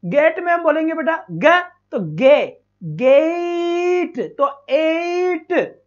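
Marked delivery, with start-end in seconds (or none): 2.8-4.2 गेट stretched very long as "geeeiit"; very slow teaching demo of गेट, long ei; drawn-out vowel. Shown as a demonstration. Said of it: "देखो कैसे बोलेंगे हम". 4.7-5.8 एट stretched very long as "eeeit"; very slow demo of एट with a long glide; drawn-out vowel. Shown as a demonstration. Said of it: "एक की sound आती है".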